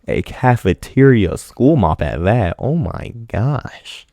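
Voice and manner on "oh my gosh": ordinary delivery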